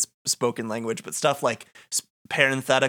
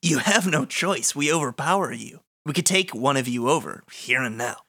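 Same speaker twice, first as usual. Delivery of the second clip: deliberate, theatrical villain threat; the darkness. Acted. Darkness threatening heroes who refuse to open the gate, deliberate menace. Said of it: "I can be the darkness".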